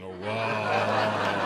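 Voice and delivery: low, dazed stoner "oh wow"; a stoner's slow, drawn-out amazement; stoned awe. Retold or imitated. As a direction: mumble it deep and spaced out, mind blown. Stoned man at binoculars.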